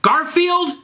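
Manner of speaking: high scolding scream of his name. Acted. Ebony scolds Garfield, high angry scream. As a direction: shriek his name in anger. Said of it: "screamed Ebony".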